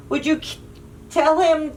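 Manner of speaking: ordinary delivery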